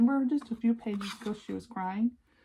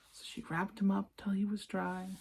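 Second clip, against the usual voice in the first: usual speaking voice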